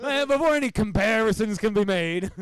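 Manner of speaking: high, loud comic outburst; hammed-up, high-pitched urgency; mock urgent order. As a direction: blurt it loudly over laughter. Acting out a hasty cover-up.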